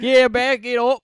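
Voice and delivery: high, slow, very loud Aussie caricature; Australian accent. Retold or imitated. Greek comic mocking Australians in bed, Australian accent. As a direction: belt it out in mock Australian, stretching the words. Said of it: "In Australia, it was, he'd be like".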